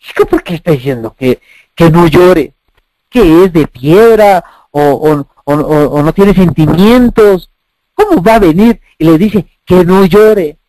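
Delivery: low, incredulous voice of a doubter; skeptical objector. Retold or imitated. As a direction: voice the scoffers' disbelief.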